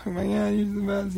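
high, soft, rushed gushing; adoring wife. Retold or imitated. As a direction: imitate a grateful wife, high and quick. Imitates his adoring wife.

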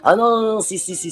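high, quick-fire insistence; insistent overruling. Retold or imitated. Director overrules the singer's objection to the accent, insistent. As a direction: brush the objection aside, pitched high.

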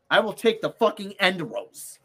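ordinary delivery

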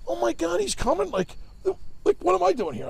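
high-pitched, flustered imitation; retold flustered outburst in a high voice; mock disbelief. Retold boss reacts to Fanatic coming.

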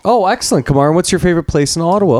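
overly friendly diner. Acted.

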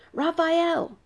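loud, high cry of alarm; panicked demand. A narrator's reading. Zachary demanding to see Raphael, panicked. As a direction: call out for Raphael, high and urgent.

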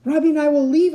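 high, loud pleading; high-pitched frantic appeal; desperate plea. A narrator's reading. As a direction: bargain loudly in panic. Cornered child bargains with robot.

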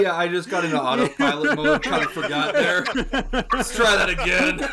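cracking up at own slip; really laughing while owning up. Real, not acted.

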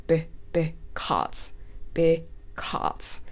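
slow, soft "because", split into be and cause, no R; tongue-flat demo. Shown as a demonstration. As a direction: draw out "because" slowly, tongue kept down. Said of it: "舌は全部ベターっと寝たまま発音する音です".

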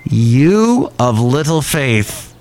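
slow, drawn-out chiding; acted reproach. Jesus chiding his doubting disciple, slow reproach. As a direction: stretch it out as a pointed rebuke. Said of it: "He says".

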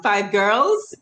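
usual speaking voice

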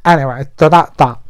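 ordinary talk, nothing marked

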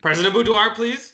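high, loud scolding host; stern moderator. Acted. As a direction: call him to order. Moderator scolds interrupting candidate.

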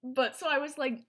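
normal talking voice

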